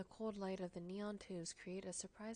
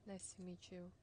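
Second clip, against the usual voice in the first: usual speaking voice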